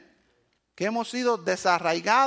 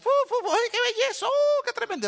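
high, gushing admiration; tourists in awe; 14 semitones higher than usual. Retold or imitated. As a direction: coo over the peacocks like dazzled tourists.

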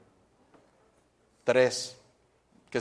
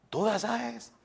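higher, softer disbelieving question; amazed dad; 8 semitones higher, 6 dB quieter than usual. Acted. Father to Juanito, asking in soft, high disbelief. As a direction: marvel at the news under your breath.

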